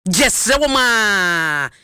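very slow, stretched-out final word; drawn-out plea. Real, not acted. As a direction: beg them, dragging out "wɔm a" in one long falling tail. Plea repeated, long drawn out.

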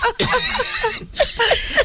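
a long, high burst of laughter. Real, not acted. Host laughs at claiming a male version of the name. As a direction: laugh hard at your own joke.

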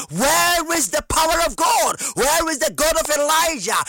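ordinary talk

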